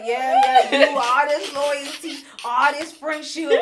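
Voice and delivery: loud, high, scornful mock rant; mocking heated retort. Amara snapping back at Shay, loud mock scorn. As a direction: throw it back at her, fed up and loud. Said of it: "And Mara's like".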